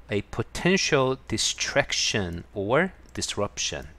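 each word drawn out for learners; slow reading. Shown as a demonstration. Teacher reads the definition to Korean learners, very slowly. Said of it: "teacher rereads definition".

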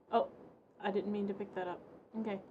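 ordinary talk

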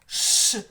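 "Ш" as a loud voiceless hush; isolated consonant sound. Shown as a demonstration. Teacher modelling the first sound, loud hissed "Ш". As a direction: push air through the teeth for a strong "Ш". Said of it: "Давайте перший промовили ротиком".

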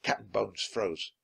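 ordinary delivery, nothing marked

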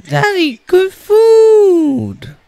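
high, loud, drawn-out plea; little kid's high-pitched cheer; excited child. Acted. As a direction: whoop it like a delighted little kid. Child pleads for the food.

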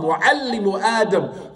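normal talking voice

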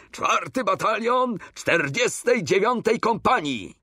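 high-pitched parade-ground call; roared call. A narrator's reading. Coretti hailing the king with his unit, roared call. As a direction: call it out high, having lost your head. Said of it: "jakże nie ryknie".

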